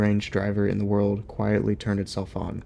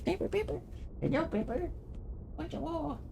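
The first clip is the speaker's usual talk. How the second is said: very high, softer singsong; 13 semitones higher, 4 dB quieter than usual. Real, not acted. Owner cooing her dog's name, high soft baby talk. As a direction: coo at the dog, rising higher.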